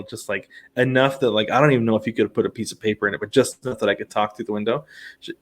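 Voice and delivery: ordinary talk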